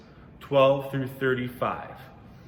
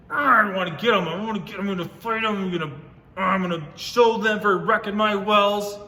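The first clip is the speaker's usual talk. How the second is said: higher, louder pretend grumble of revenge; mock frustrated; 8 semitones higher, 7 dB louder than usual. Voicing Isaac fed up with the wrecked wells, mock frustrated. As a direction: fume like a cartoon grump. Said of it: "just wanna be like".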